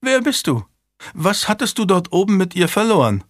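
high, sharp questioning; suspicious governess. A narrator's reading. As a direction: demand answers from a strange man. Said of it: "beäugte den Mann mit Argwohn".

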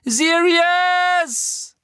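high, loud desperate cry; screaming a name. A narrator's reading. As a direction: scream it with everything. Harry screams after Sirius.

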